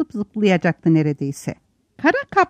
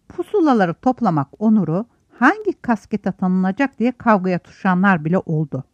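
ordinary delivery

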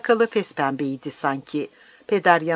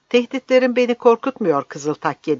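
higher, louder voice of defiance; defiant retort; 8 semitones higher, 5 dB louder than usual. Acted. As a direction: throw the threat straight back. Priest defies the threat.

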